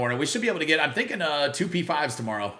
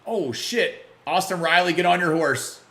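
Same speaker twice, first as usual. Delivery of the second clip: ordinary talk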